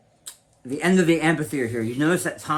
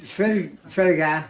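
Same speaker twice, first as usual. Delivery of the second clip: put-on Boston accent; 5 semitones higher, much slower than usual. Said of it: "she'll do it in the Boston accent".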